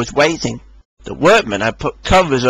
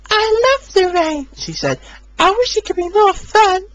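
high bright little engine; cheerful Rosie. A narrator's reading. Rosie breezily reassuring Hector, high voice.

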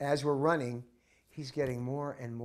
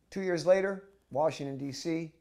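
usual speaking voice

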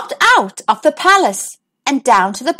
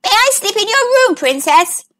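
much higher, quick little frog plea; hopeful frog; 8 semitones higher than usual. A narrator's reading. Frog asks the princess to sleep in her room, high and quick. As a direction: ask it like an eager small frog. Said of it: "the frog asked".

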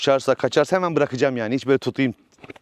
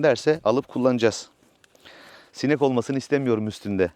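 usual speaking voice

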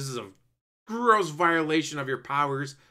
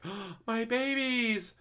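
tender longing; 10 semitones higher, slower than usual. Retold or imitated. Wanda sees her kids.

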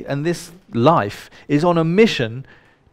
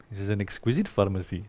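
soft, lower Indian-accented imitation; playful Indian accent, said quietly; Deepak Chopra; 6 semitones lower, 9 dB quieter, faster than usual. Speaker quotes a lecturer to the audience, imitating his Indian accent. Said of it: "It's great how he says it".

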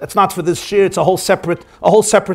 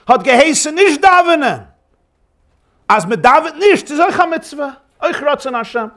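raised, higher-pitched urging; impassioned argument; 6 semitones higher than usual. Acted. Zusha rallies his crying brother in the cell, impassioned. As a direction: argue it fervently, pitch lifted.

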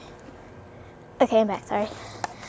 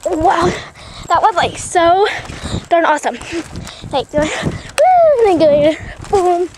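excited; 4 semitones higher, 6 dB louder, slower than usual. Real, not acted. Describing the jump excitedly.

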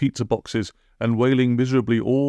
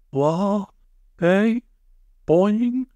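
high, rising bewildered blurts; startled and muddled, high-voiced; confused gabbling; 10 semitones higher, much slower than usual. A narrator's reading. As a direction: blurt each word as a baffled question. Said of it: "she gabbled, looking around in confusion".

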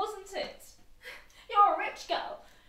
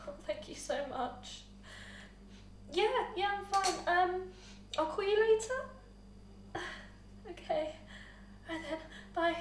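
whispering on the phone; 3 semitones lower, 7 dB quieter than usual. Acted. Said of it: "whispering on the phone".

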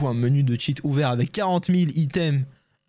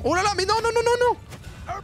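panique; faster than usual. Real, not acted. Panique quand l'armée attaque.

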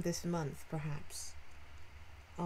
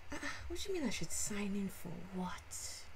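usual speaking voice

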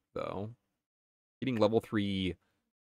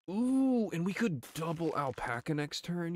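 excited planning; 9 semitones higher than usual. Real, not acted.

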